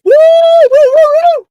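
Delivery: very high, loud whooping; enthusiastic cheer. A narrator's reading. Sister cheers her sibling at the sorting, loud high whoops. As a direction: whoop wildly for your sibling. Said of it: "as Ernesia cheers".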